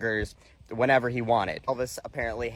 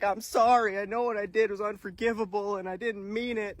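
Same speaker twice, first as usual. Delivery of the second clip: mock crying apology; 6 semitones higher, 3 dB quieter than usual. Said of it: "Then Hamburger James starts crying".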